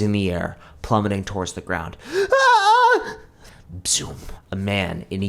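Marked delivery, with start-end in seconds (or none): 2.1-3.2 very high, loud scream; boy screaming as he falls; falling scream. A narrator's reading. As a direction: scream while plummeting. Joe falling.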